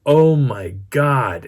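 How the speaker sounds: slow, loud stunned "oh my God"; awestruck. Retold or imitated. Storyteller clinging to the vehicle after the scream, slow loud awe. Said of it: "holding onto my vehicle going".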